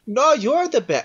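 high cat character, cut short. Acted. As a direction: start high as the cat, then break off. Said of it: "I'm stuck in the cat".

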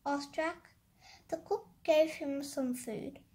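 ordinary delivery

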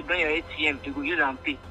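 ordinary talk

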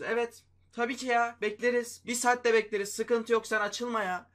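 high, loud mock patience, drawn out; sarcastic, exasperated waiting; sarcastic impatience. Real, not acted. Sarcastic about slow pack.

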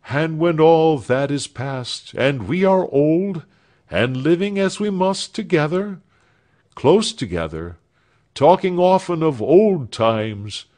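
Alfred voiced with hopeful fervour; earnest young man. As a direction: promise the future with feeling. Alfred speaks at parting.

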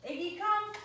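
high-pitched recall call; real, high dog-training command. Real, not acted.

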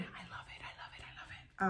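breathy repeated whisper. Real, not acted. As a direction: whisper it lovingly to yourself. Whispered love of Gatorade.

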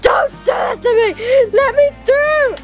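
high, crying shouts; impassioned plea. Acted. Begging to get home to his brother, tearful shouted plea. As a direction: beg desperately at full voice, breaking down at the end. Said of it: "That was a very strong speech".

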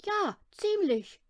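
put-on a shy child admitting it; little boy. A narrator's reading. Boy admits to mother he likes Gunilla, high and slow. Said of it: "sagte Lillebror".

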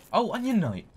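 high, loud delighted shout; excited. Real, not acted. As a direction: call out in glee. Spots the Onion Knight.

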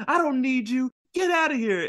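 high, pushing-away shout; angry Nami. Retold or imitated. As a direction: send him off, mock angry. Nami yells at Luffy, retold.

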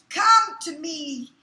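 slow beckoning. Retold or imitated. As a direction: draw them in, drawn out and loud. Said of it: "They saying".

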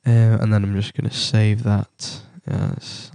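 ordinary talk

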